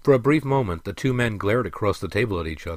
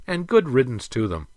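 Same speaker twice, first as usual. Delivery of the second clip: higher, dismissive retort; countering; 5 semitones higher, 4 dB louder than usual. A narrator's reading. Prince counters his father about the dwarfs, dismissive. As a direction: dismiss them with force. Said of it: "countered the prince".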